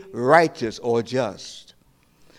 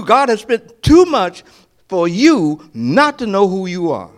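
louder, higher preaching, building to a cry on the question; preaching at full force; 7 semitones higher, 7 dB louder than usual. Acted. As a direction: preach it, climbing to a shout.

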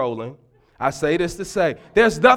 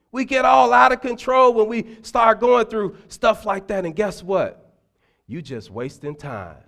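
usual speaking voice

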